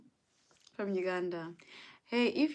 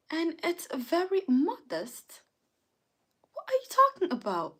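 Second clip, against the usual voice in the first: higher, mock-indignant British accent; 4 semitones higher than usual. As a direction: defend yourself in a put-on British accent. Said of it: "would you like me to speak like this".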